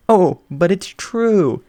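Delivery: slow, drawn-out doting sigh; dreamy swoon. Acted. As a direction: melt over it slowly. Midnight swooning over the canaries.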